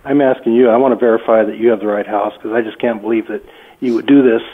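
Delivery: ordinary delivery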